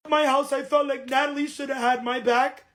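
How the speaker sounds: ordinary talk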